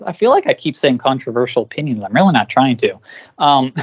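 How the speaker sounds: ordinary delivery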